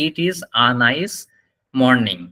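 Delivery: slow demo, "a" as "ah"; "a" pronounced আ. Shown as a demonstration. Teacher asking students to note it, slow model sentence. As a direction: stretch it out, saying "a" as "ah". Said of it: "ভালো মতন এটা খেয়াল করবেন".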